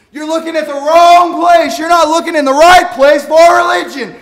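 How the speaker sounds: high, loud, fervent preacher's cry; fiery pulpit shout, slowing on the last line; preaching at full force. Acted. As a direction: shout it to the congregation like a revival preacher. Preaching peak.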